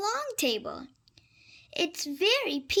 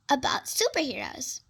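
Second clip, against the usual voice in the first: ordinary delivery, nothing marked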